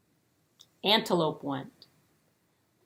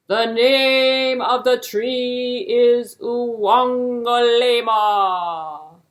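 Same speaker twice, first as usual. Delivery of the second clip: slow, loud, regal king's voice; booming king; 4 semitones higher, 8 dB louder, much slower than usual. Acted. King grandly telling hare the tree's name, loud and slow.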